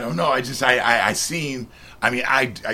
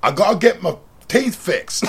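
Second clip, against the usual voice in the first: hammed-up English accent impression; 3 semitones higher, 4 dB louder than usual. Shown as a demonstration. Passer-by does best English impersonation for interviewer, English accent. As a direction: put on a joke English accent. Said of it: "Can you do an English accent?".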